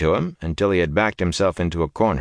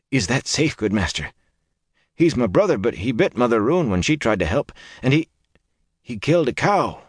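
higher, worried peasant voice; anxious villager; 6 semitones higher than usual. Acted. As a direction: plead it nervously, pitched up. Simeon voiced by narrator.

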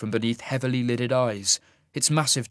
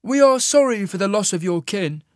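high, loud, formal condolence; composed immortal; 9 semitones higher, 6 dB louder than usual. A narrator's reading. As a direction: offer it in a high, commanding voice. Isaac's character voice.

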